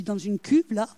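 ordinary talk, nothing marked

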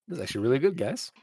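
put-on soft, quiet aside to the kids; hushed. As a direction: hush it so only the kids hear. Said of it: "I kind of say that in, like, a hushed tone".

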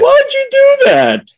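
put-on very high, whining child's cry; little British kid. Little boy to the brother who bit him, imitated wail. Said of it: "This little British kid".

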